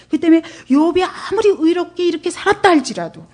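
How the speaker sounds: ordinary talk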